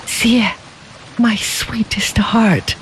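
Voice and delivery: tender whisper, half joy, half sorrow; glad and sad. Acted. Koen Lo answering his bride, glad and sad. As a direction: whisper it slowly, happy yet grieving. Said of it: "his voice both glad and sad".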